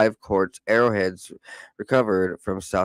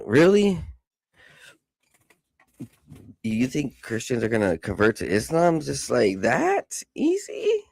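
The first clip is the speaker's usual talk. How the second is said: incredulous; 4 semitones higher than usual. Real, not acted. Incredulous rhetorical questions.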